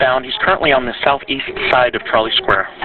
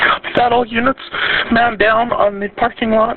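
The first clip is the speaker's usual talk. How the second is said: police radio; 10 semitones higher than usual. Real, not acted. Dispatcher answering over police radio.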